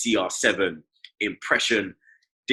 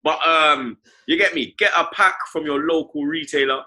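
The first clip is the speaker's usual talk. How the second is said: hyped pitch; 7 semitones higher, 4 dB louder, faster than usual. Acted. Hyped sponsor pitch about summer.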